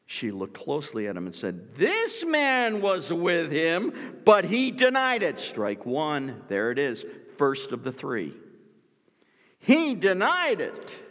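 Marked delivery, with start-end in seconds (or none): 1.8-5.4 put-on high, loud accusing cry of a servant girl. Servant girl accusing Peter, high loud accusation. 9.6-11.0 high, loud and slow; dramatic accusation. Acted. Preacher driving home Peter's denial, slow loud emphasis.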